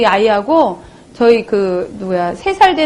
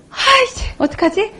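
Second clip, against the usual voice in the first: dismayed outburst; 8 semitones higher than usual. Retold or imitated. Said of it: "realizes oil missing".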